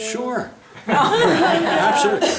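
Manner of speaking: high, loud laughing agreement. Real, not acted. Painter laughing at the end of his story. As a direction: burst out laughing on the punchline.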